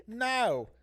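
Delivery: high loud flat refusal; blunt mock no. David refusing the goats, blunt mock no. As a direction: slam the door with one word. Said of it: "one of the stories was like".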